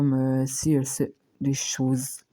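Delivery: ordinary delivery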